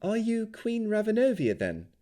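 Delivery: put-on bright, high young woman's voice; a high, friendly young elf. A narrator's reading. Elf asks.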